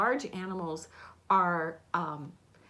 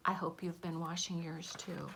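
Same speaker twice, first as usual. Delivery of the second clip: whisper; 4 semitones lower, 7 dB quieter than usual. Real, not acted. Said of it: "aside to children".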